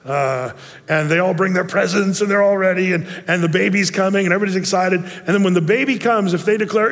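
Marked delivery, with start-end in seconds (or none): none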